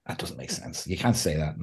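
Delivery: low, breathless whisper of disapproval; a low, unvoiced aside; mock whisper. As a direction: hiss it quietly like a critic. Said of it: "you're like".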